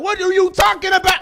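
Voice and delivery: high, loud mock outrage; exasperated yell. High comic yelling back at the microwave, exasperated. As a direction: yell it in comic disbelief, voice jumping up.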